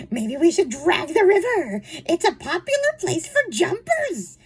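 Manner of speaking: high, chirpy mock suggestion; cheerful maid impression. Maid suggesting they search the river, imitated cheerfully. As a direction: blithely offer a grim idea in a high voice. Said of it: "she is so cheerful about the idea".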